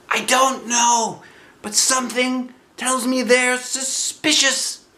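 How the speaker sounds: high, bright bear voice calling out; Pooh's cheerful cry. Acted. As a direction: call like a happy little bear. Pooh voiced.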